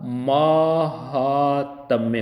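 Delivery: महात्म्य drawn out as maaa-haaa-tamya; very slow, sustained महात्म्य; long first vowel demonstrated. Shown as a demonstration. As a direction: slowly stretch महात्म्य, maa and haa both long. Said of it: "तो क्या बन गया".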